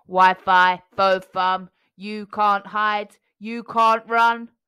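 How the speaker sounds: loud, slow robot chant; robotic giant voice. A narrator's reading. Giant chasing.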